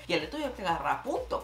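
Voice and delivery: ordinary talk, nothing marked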